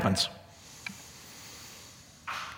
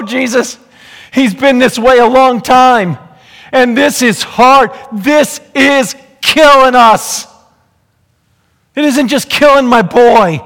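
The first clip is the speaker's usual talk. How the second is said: desperate plea; 8 semitones higher, 7 dB louder, much slower than usual. Acted. Father's desperate confession voiced.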